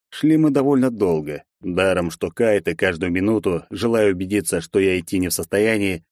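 ordinary delivery, nothing marked

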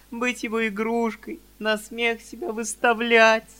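high, loud, slowing plea through tears; lightly tearful girl imploring; tearful pleading. Acted. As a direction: beg your father, close to sobbing. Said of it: "заливаясь слезами".

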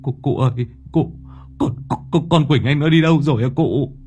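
high, tearful, stammering cry for help; sobbing plea. A narrator's reading. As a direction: choke out the words in panic. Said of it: "Anh Thiên mếu máo".

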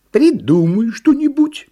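high, loud, slow request; drawn-out elephant plea; pleading elephant. A narrator's reading. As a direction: beg eagerly as a little elephant. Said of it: "попросил слонёнок Баня".

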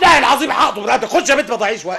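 angry threat. Acted. Master threatening the servant girl, loud and fast. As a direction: snap the threat out quickly and loudly.

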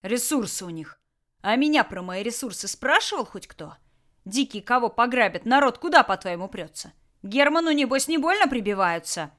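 lazy threatening boss voice; gruff bandit boss. Acted. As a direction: bandit leader menacing his prisoners. Tolyan argues scornfully.

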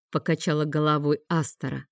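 ordinary delivery, nothing marked

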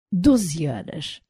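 slow, quiet "Doze horas"; disbelieving repeat. A narrator's reading. Corax repeating the twelve-hour order, quietly disbelieving. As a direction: echo "Doze horas" softly and slowly, unimpressed. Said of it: "repetiu Corax, abanando a cabeça".

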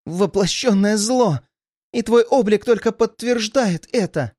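high-pitched, defiant condemnation; a doomed captive's brave accusation; accusing. A narrator's reading. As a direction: denounce the monster with the voice pitched right up. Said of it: "обличительно выдохнула женщина".

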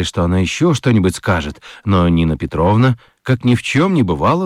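ordinary talk, nothing marked

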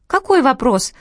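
high squeaky little voice. A narrator's reading. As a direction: squeak it out like a toy. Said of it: "пропищала Лена".

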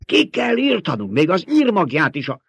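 high, strained, vehement voice; hoarse urging. A narrator's reading. Baron demands they be wiped out, hoarse and vehement. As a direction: push it up into a strained cry. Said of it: "mondta reket hangon a báró".

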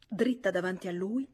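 ordinary delivery, nothing marked

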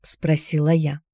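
usual speaking voice

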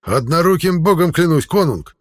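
high, loud joyful oath; fierce joy. Acted. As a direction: swear it with fierce delight. Second viking confirms joyfully.

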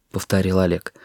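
ordinary delivery

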